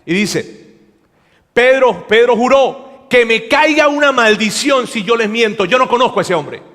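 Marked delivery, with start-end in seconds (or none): 1.5-6.6 raised, louder, charged swearing; heated retold oath, loud and insistent; emotional oath. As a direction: curse yourself to be believed. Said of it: "está cargado emocionalmente y contesta de esta manera".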